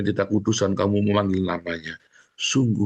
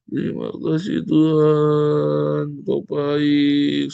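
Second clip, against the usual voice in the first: loud tearful prayer; 5 semitones higher, 4 dB louder, much slower than usual. Shown as a demonstration. Models loud crying prayer.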